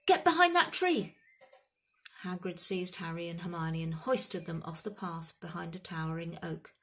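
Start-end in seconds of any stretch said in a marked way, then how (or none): 0.1-1.2 high, loud cry of alarm; urgent shouted warning. A narrator's reading. Hagrid warns the children of danger, urgent shout. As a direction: shout them into cover at once.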